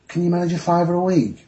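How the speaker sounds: pathetic pleading. Retold or imitated. Judge asking the defendant for a small payment, mock-pathetic pleading. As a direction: imitate a judge meekly coaxing. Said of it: "he had a pathetic expression".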